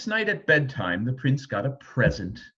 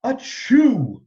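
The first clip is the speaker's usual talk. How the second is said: louder, higher mock sneeze; wheezy sneeze; 10 semitones higher, 7 dB louder than usual. A narrator's reading. King caught in bursting feathers, sneezing. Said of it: "wheezed the king".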